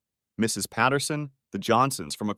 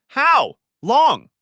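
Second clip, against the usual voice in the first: high, very loud demand; furious shout; 10 dB louder than usual. Acted. Husband screaming at his wife how long, furious. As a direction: scream the question at her.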